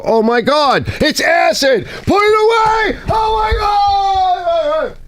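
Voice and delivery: hammed-up terrified yelling, dragged out at the end; mock panic. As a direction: freak out over the acid like it's poison. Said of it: "people would look at this and go".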